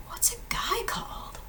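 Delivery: whispered aside; conspiratorial whisper to the class. Real, not acted. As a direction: drop to a whisper for the question. Teacher stage-whispers aside.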